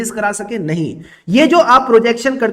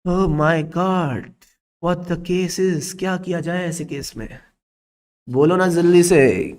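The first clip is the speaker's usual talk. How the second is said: slow, lower, drawn-out coaxing of the class; teasing plea; 5 semitones lower, slower than usual. Acted. Teacher coaxing silent students to answer, slow teasing drawl. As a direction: dangle the question like a playful tease.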